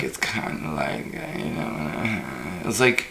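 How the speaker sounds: soft creaky voice, trailing away; mock California vocal fry. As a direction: imitate a California girl's throaty creak. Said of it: "people have that kind of".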